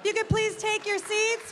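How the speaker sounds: normal talking voice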